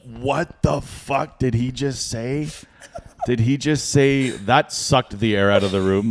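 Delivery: slow, stunned incredulous questioning; retold disbelief. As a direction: draw out each word in disbelief. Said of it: "and I was like".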